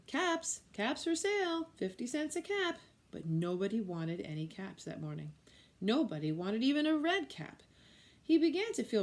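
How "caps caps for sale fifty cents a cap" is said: high sing-song street call. A narrator's reading. Peddler to passers-by, high calling out.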